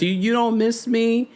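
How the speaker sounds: high, slow, plaintive mock pleading; imitating Angela. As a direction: whine it like a lonely wife. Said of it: "she says".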